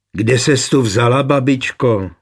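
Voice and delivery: plain reading voice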